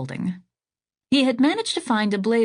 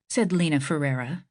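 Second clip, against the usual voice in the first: ordinary delivery, nothing marked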